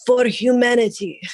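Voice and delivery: put-on light Middle Eastern accent; hint of a Middle Eastern accent. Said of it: "really strong, like, Middle Eastern accent".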